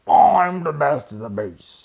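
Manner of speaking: loud, slow boasting bear; boastful bear. Acted. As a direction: puff up and boom it out.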